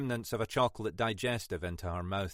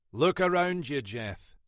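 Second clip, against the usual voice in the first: usual speaking voice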